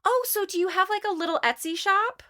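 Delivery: high-pitched mock sweetness; patronizing tone. People asking about her Etsy shop, mock patronizing. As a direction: imitate someone belittling a small business. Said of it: "not use that tone with me".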